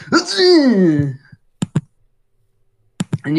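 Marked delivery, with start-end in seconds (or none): 0.1-1.1 sneezing grunt. Retold or imitated. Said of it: "It says".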